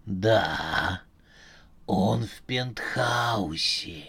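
low, slow robotic voice; slow, low, machine-like quiz host; metallic voice. Acted. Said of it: "металлический голос".

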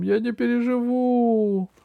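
high, loud theatrical wail; squeaky lament of despair; sobbing gnome. Acted. Gnome mourns his bride, high hammed-up wail. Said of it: "рыдал гном".

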